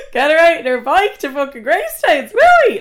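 high, loud rant peaking on the final question; sarcastic shouting. Retold or imitated. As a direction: yell the mock suggestion in outrage.

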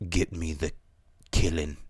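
mock movie villain. Fan mocking the villain's lines, low quiet menace. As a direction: growl it low like a brooding villain. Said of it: "where he's like".

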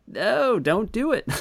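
high-pitched, playful cry of dismay; mock pleading. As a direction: plead with the screen in hammed-up despair. Viewer's mock plea at the screen.

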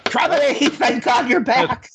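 high-pitched, drawn-out cry of relief; desperate relief. Acted. Trapped in the wrong body, greeting Cromitty on return, desperately relieved. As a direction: greet a rescuer in a high, urgent voice.

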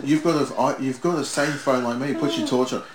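retelling an impatient instruction; retold exasperated telling-off. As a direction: insist as if it's obvious. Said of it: "I said".

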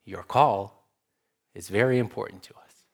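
imitating a recorded phone line voice, smooth and fast; automated phone voice. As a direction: put on the polite canned voice of a hotline recording. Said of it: "the voice on the other line assures you".

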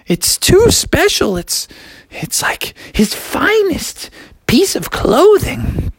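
high falsetto mom, slowing with concern; put-on falsetto mom, drawn out. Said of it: "his mom was like".

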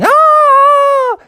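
very high, loud held shriek; long comic terrified wail; ranger's scream. Acted. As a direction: scream as if on a runaway bear.

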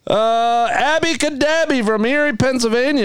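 high, slow, drawn-out announcer call. Acted. Host reading a listener's name, hammed-up announcer call.

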